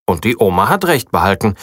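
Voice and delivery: usual speaking voice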